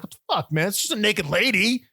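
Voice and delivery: high, loud, scoffing; mock jock. Friend mimics the other jock scoffing at Mike, high and loud. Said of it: "the other guy's like".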